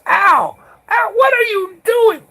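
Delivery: very high, loud startled yell; imitating an alarmed shout. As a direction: shriek it across at your own men. Patrol chief yells at his men.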